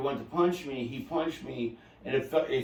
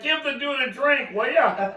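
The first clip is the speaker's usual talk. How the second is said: high, loud put-on voice of the crowd; mock crowd voice; 12 semitones higher, 6 dB louder than usual. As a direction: ham up the onlookers calling for a drink. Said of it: "They're like".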